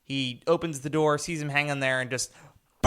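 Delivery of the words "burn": long held brassy note sung out; imitated dramatic trumpet blare. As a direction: hold it like a film score's sudden blare. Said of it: "dramatic trumpet blares and stuff".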